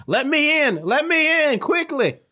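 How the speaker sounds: high, urgent pleading; fake Sludge voice. Krabina mimicking Sludge to get in, high pleading. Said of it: "sounds like your good friend, Sludge".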